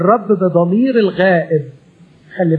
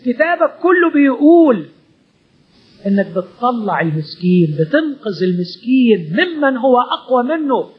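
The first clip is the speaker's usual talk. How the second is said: earnest prayer, rising higher in the pleas; pleading prayer; 4 semitones higher than usual. Acted. Preacher praying aloud to God before the congregation, pleading. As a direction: pour out your complaint to God, begging.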